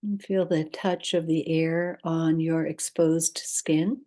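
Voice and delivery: calm, unhurried guidance with long pauses; guided meditation. Acted. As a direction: lead listeners gently into rest, leaving space between instructions.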